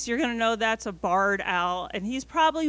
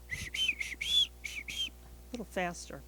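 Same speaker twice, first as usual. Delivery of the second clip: usual speaking voice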